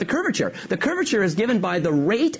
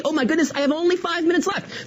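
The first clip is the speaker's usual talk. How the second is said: high, startled dash of alarm; Oh my goodness, half-laughing alarm; mock panic; 9 semitones higher than usual. Real, not acted. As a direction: gasp at the clock running out. Realizes the lecture time is short.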